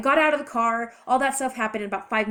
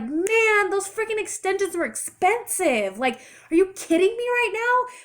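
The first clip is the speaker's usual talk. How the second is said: much higher, louder outraged cry; exasperated outburst; 10 semitones higher, 5 dB louder than usual. Retold or imitated. Woman retelling her reaction to losing new extensions, exasperated. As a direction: retell it as indignant disbelief, pitch way up.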